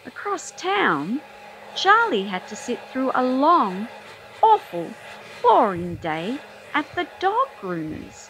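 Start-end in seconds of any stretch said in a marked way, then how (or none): 1.8-8.3 a long, AWFUL, boring story, with awful wailed; awful stressed. A narrator's reading. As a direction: moan the word awful in mock agony. Mock dread, repeated.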